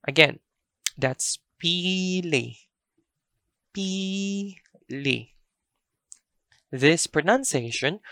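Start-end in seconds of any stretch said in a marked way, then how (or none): 1.6-5.3 "pili" very slow, first ee held long and higher; slow, higher "pili" with a prolonged first I; long first vowel. Shown as a demonstration. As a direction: stretch out the first vowel of "pili", then a normal open ending. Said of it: "E is a long vowel".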